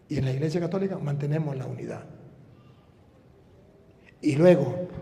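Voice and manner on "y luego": usual speaking voice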